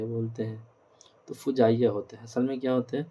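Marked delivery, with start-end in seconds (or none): 1.3-1.9 فجائیہ said as "fujaiya"; short u in the first syllable of فجائیہ; correct "fu" pronunciation. Shown as a demonstration. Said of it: "Urdu کی pronunciation". (فجائیہ کو پیش سے "فُجائیہ" کہنا)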